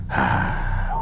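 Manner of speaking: ordinary delivery, nothing marked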